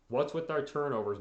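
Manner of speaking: usual speaking voice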